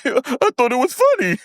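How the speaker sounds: very high, squealed imitation; mimicked giggler. Recapper imitates Maurice defending his giggling, high squeal. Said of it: "giggling at something that was actually funny".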